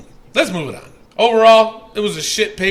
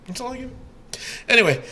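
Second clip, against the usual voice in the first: normal talking voice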